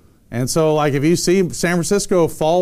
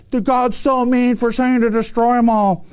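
higher, put-on voice of complaint; mock indignant objector; 6 semitones higher than usual. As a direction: imitate a whiny objector, pitched up. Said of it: "people are just like".

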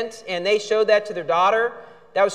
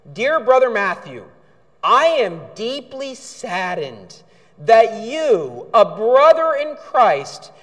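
slow, drawn-out, syrupy mock-solemn read; milk every word like a fake saint; mock pious reading; slower than usual. Pastor mocks the con man's letter with slow, syrupy piety. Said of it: "The same sort of over the top".

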